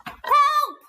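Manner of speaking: high, loud call for help; shouted cry for help. Shown as a demonstration. Teacher demonstrating an exclamation with a loud cry for help. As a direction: yell it out like you really need help. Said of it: "I need to say that loudly".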